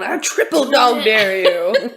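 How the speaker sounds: slow, drawn-out goading shout; mock dare shout. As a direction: egg her on like a kid daring. Egging friend on.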